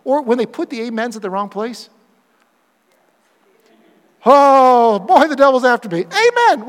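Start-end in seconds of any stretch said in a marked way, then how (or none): 4.3-6.0 loud, anxious outcry; hammed-up panic; mock alarm. Amens put at the wrong place. 6.0-6.7 high, loud sung-out amen; hammed-up amen from the pews; mock amen.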